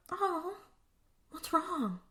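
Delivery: soft, high-pitched cooing; mock coaxing. Demon orderly to frightened Anna, soft mock coaxing. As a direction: coo it quietly, feigning concern.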